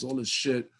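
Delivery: ordinary talk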